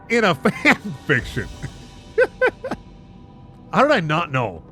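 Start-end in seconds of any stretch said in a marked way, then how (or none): none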